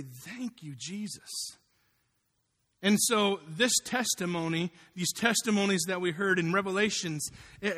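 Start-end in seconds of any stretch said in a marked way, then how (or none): 0.0-1.6 hushed, much softer gratitude; sincere thanks. Real, not acted. Preacher showing his thanks is not fake, quietly sincere. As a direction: drop the volume right down and mean it.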